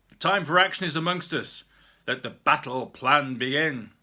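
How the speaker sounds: rallying call. Acted. Mister O rallies the clan.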